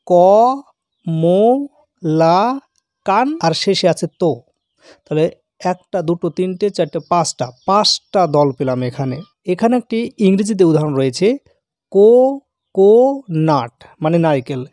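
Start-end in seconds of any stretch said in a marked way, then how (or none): 0.1-3.3 "ক ... ম ... লা ... কান", long pause after each; each syllable of "ক, ম, লা, কান" held alone. Shown as a demonstration. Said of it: "কমলাকান্তের কটা দল আছে". 11.9-13.7 "কো ... কো ... নাট" with clear pauses; one push for each of "কো", "কো", "নাট"; three separate breaths. Shown as a demonstration. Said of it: "তিন নিঃশ্বাসে উচ্চারণ করতে হয়েছে".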